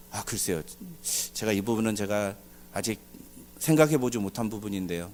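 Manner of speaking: low, subdued, hesitant answer; hesitant reply. Acted. Pastor admits to congregation he has no answer, hesitant and low.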